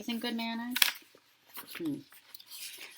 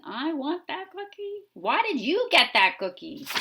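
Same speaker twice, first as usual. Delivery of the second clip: much higher, loud whining demand; whiny pigeon; 8 semitones higher, 6 dB louder than usual. Acted. Teacher voicing the pigeon demanding the cookie, whiny. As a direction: whine like a jealous bird.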